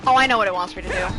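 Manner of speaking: ordinary talk, nothing marked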